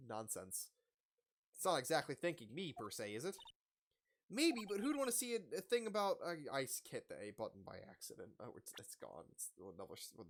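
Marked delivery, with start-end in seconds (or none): none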